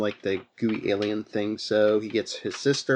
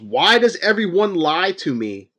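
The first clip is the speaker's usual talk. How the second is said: raised, higher mock yell; a half-acted yell of betrayal, pitched up; retold yell; 6 semitones higher, 5 dB louder than usual. Sam yells at Rich over the lies, raised and higher. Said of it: "and he yells".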